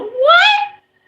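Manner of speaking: high, stretched, laughing "what?"; shocked what. Retold or imitated. Hearing the freaky plan, shocked laughing. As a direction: gasp it out in disbelief. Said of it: "And I go".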